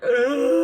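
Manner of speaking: high, loud choked sob of a groan; a wailing ugh from tears; sobbing groan. Retold or imitated. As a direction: let out a strangled crying ugh. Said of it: "And I'm just like".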